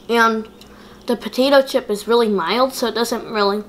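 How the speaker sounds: talking between bites; chewing while talking. Real, not acted. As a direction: mouth partly full. Seen 1: Chip bitten at -7; jaw and lip chewing continue through the labelled words.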